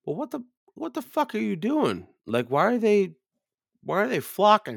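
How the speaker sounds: high-pitched, baffled exasperation; acted-out incredulous grilling; exasperated questioning. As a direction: demand an explanation in disbelief. Acted exasperated questioning of his friend.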